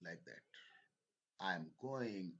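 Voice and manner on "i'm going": "going" carries the stress, "I'm" shrunk small. Shown as a demonstration. Teacher showing the helping verb shrinking, stress on "going". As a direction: make "I'm" tiny and land on GOING. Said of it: "am to chhota sa reh gaya".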